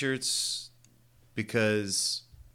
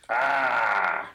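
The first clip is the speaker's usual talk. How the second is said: shark impression. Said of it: "shark impression".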